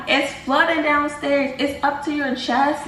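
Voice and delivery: high-pitched mock panic, not a full scream; retold panicked scream. Niece warns uncle of flooding in retold dream, panicked. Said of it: "I'm running and I'm screaming, I'm panicking".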